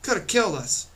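high, indignant shout; indignant yell. A narrator's reading. Girl yells straight up at bag throwers, indignantly. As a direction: yell it up at whoever did it. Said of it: "She yelled again".

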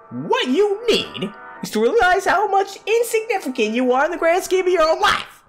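high, hammed-up berating; scornful tirade. Cruel inner voice belittles the character, scornful. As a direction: berate in a strained high pitch.

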